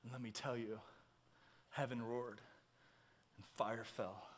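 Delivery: very soft, low sermon voice; hushed preaching. Real, not acted. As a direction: bring the congregation down to a hush. Hushed telling of fire falling.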